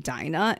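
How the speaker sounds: "Dinah" with a long eye vowel, pointedly; corrected pronunciation. Retold or imitated. Blonde in the doorway correcting the name, "Dinah" said as DIE-nah. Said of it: "Do you mean Andrea when you ask for Andrea".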